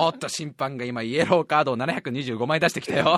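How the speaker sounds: mock play-by-play.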